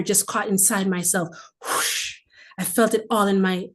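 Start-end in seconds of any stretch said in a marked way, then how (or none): 1.6-2.2 breathy, voiceless whoosh of a flame. Retold or imitated. Telling viewers how the fire caught, a voiceless whoosh. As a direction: blow it out like a fire catching. Fire catching inside her.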